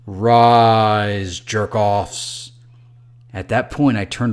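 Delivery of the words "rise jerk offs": loud, drawn-out commanding boom. Acted. Dream voice waking the dreamer, booming. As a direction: thunder it out like a voice of doom. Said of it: "louder than you can imagine".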